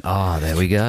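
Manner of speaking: mock the line like a duffer; dufferish imitation. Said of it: "sounded comically dufferish".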